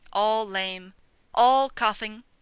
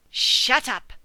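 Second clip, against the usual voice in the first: louder sharp snap; barked order; 3 semitones higher, 6 dB louder than usual. Acted. Sheepdog silencing the sheep next door, barked order. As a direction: bark it at the noisy sheep. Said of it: "she barked".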